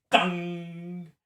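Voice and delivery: high, ringing imitation of a clang; big dong. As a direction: sound out a big metallic clang. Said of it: "you hear a big".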